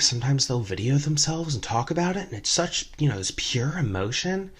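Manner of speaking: put-on creepy drunk woman. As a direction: let her ramp into a morbid reverie, hushed. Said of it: "So she says".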